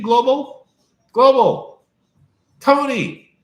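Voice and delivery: loud, high calling out; calling out a name. Real, not acted. To a live chat viewer, calling out excitedly.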